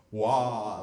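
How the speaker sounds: wa on a loud open vowel; wa, a loud open relaxed ah. Shown as a demonstration. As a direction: open the mouth wide on wa. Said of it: "that would be no problem".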